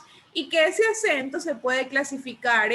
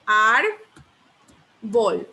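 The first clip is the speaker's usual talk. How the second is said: "árbol" broken in two, a loud ÁR, long pause, then bol; separated into syllables; 4 semitones lower, 4 dB louder than usual. Shown as a demonstration. Teacher dividing a word for pupils, "árbol" syllable by syllable. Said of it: "si yo separo en sílabas".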